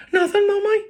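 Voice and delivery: put-on high, quick child denial; little boy's voice. A narrator's reading. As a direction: answer Ma in a high little-boy voice. Child fending off mother's question.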